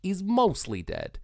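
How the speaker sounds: lightly voiced Miracle Max. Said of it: "Miracle Max goes".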